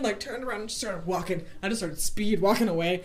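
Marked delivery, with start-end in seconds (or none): none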